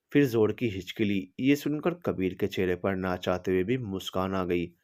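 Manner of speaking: usual speaking voice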